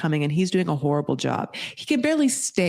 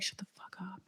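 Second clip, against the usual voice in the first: harsh whispered snap; furious whisper to an embarrassed partner; whispered hiss; 19 dB quieter than usual. Retold or imitated. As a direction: hiss it so the parents won't hear. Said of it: "She's like".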